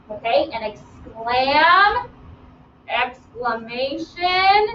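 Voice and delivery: "exclamation" stretched very slowly, rising at the end; drawn-out word. Shown as a demonstration. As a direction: drag out "exclamation" so the root is clear. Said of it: "You hear that?".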